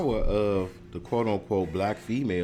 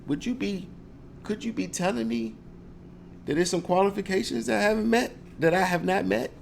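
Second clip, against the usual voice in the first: mock polite; 7 semitones higher than usual. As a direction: feign innocence to trap the clerk. Mock polite challenge to clerk.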